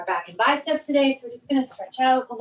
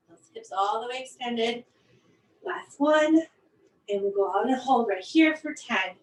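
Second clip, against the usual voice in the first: ordinary talk, nothing marked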